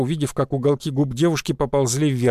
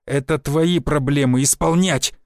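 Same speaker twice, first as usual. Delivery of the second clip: plain reading voice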